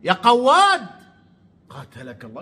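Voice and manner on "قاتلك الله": low, much softer curse under the breath; muttered curse. Real, not acted. Vlogger cursing Amr under his breath, muttered. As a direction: hiss the insult half to himself.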